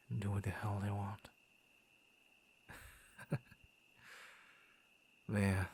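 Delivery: ordinary talk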